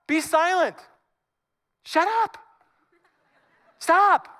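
high, loud, abrupt orders; forceful command. Acted. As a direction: call out sharply to silence a crowd. Moses commanding the panicked people.

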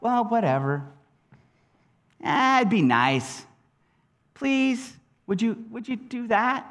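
offhand, halting mock indifference; casual shrug. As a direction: toss it off like a half-hearted request. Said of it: "I can't imagine that it was like".

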